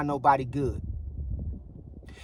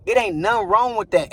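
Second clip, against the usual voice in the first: ordinary talk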